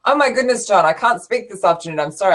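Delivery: usual speaking voice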